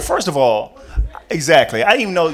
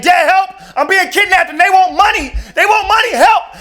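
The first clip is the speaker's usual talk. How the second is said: high, loud panicked yelling for dad; frantic kidnapped son screaming; 7 dB louder than usual. Acted. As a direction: shriek for help like you're being dragged off. Son panics on kidnap phone call.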